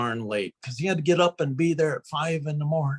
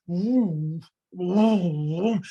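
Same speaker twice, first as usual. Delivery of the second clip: mock pulsing whoosh; 3 semitones higher, much slower than usual. Imitates pulsating ghost.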